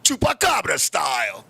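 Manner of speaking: low, slow, shouted kung fu movie challenge; deep, drawn-out mock Shaolin challenge. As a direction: hurl the insult like a film martial artist. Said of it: "I was like".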